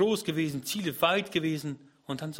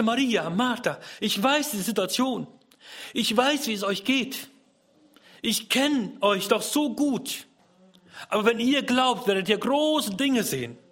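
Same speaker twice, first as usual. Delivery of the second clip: higher, louder earnest reassurance; earnest comfort; 7 semitones higher, 4 dB louder than usual. Acted. Jesus comforting Maria and Martha, earnest reassurance. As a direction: speak as Jesus consoling grieving sisters.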